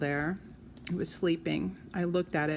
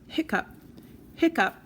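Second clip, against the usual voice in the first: hiccups; 8 semitones higher than usual. Acted. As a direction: let the second hiccup jump up. Monster's hiccups.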